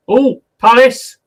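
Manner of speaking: high, loud, drawn-out match reaction; excited outburst. Real, not acted. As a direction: cry out as the chance opens up. Reacts to live chance.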